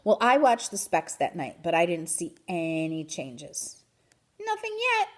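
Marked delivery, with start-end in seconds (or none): 4.4-5.1 high little squeak to a neighbor; squeaky hamster. A narrator's reading. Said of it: "I squeaked to my neighbor".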